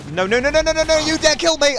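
high, loud rapid-fire protest; protesting yell. Real, not acted. Player about to be killed in game, protesting loudly. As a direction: cry out against being killed.

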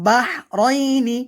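বাহরাইনি slow, with the h from mid-throat; throat articulation. Shown as a demonstration. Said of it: "হলকের মধ্যখান".

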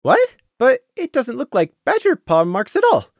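high, surprised protest. Acted. Scenic protesting to Crimson, high and surprised. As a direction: object in disbelief. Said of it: "Scenic protested".

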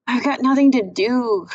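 bored sister whining to brother; whiny. Acted. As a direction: whine like a bored girl.

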